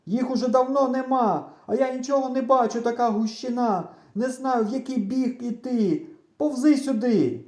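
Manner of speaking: high, insistent shouted complaint; exasperated yelling. A narrator's reading. Lost boy yelling to his friend, exasperated. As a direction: yell across the corn, fed up. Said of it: "кричу я".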